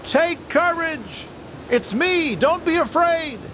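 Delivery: high, slow call carried over the waves; voice calling across the water. Acted. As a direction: call out slowly and high to frightened men on the sea. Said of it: "the voice came to us from across the water".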